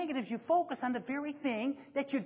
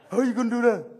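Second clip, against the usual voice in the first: a mildly imitated schoolkid, louder and more challenging; cheeky kid voice, louder; 8 dB louder, faster than usual. Speaker imitating young kids, louder mock challenge. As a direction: throw the question back like a defiant kid. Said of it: "'Cause that's how they talk".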